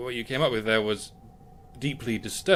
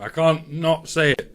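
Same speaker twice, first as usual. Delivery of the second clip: ordinary delivery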